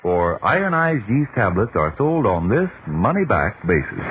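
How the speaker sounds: ordinary talk, nothing marked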